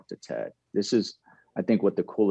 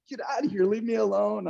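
shooing friends; 12 semitones higher than usual. Retold or imitated. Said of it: "And I'm like".

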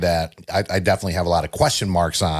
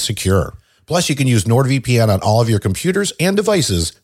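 louder, upbeat podcast ad read; sponsor ad read; 4 dB louder than usual. Acted. Podcast host reads NordVPN sponsor spot to listeners, upbeat ad read. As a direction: sell it like a sponsor spot, energetic and punchy.

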